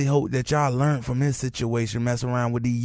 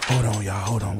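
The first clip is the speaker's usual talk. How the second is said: ordinary talk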